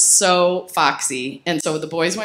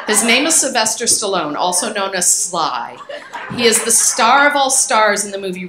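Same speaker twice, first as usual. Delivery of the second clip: loud, giggly diary reading that sends up her younger self; reading old journal aloud; 3 semitones higher, 5 dB louder than usual. Acted. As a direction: read your thirteen-year-old self with amused relish. Reads her teenage journal aloud.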